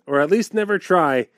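sing-song limerick recital; bouncy limerick lilt. Pretend. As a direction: bounce through the rhyme. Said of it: "would like a limerick".

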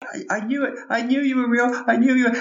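put-on high, stammering child's voice; thrilled kid. Boy tells Santa he believed all along, high and thrilled. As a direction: play an overwhelmed little boy.

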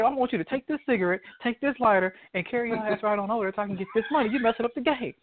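fast, high, hammed-up orders; high-pitched mock scolding, quick; mock bossy hustler. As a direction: rush the commands like an irritated crackhead. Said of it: "He said".

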